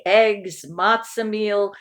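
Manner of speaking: plain reading voice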